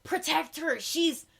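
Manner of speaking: high, loud begging shouts; desperate plea. Retold or imitated. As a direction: beg as if her life depends on it. Lear pleading desperately.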